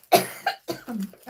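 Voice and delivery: loud dry cough. Real, not acted. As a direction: cough hard. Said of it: "Oh, dear me".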